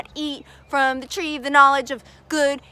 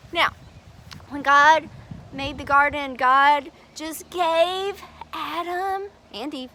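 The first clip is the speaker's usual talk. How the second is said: ordinary delivery, nothing marked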